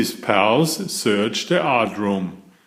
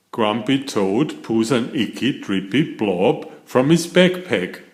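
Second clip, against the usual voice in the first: usual speaking voice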